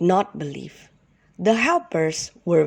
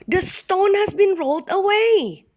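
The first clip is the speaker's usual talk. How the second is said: much higher, amazed announcement; 14 semitones higher, 3 dB louder than usual. Acted. A woman telling the others the stone is gone, high and amazed. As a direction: tell them the stone is gone, wide-eyed.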